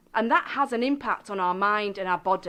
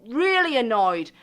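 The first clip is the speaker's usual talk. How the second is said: louder angry complaint, loudest on so angry; mock furious complaint about something gone wrong; acted anger; 6 dB louder than usual. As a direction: vent the frustration out loud. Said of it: "in the past you would have said".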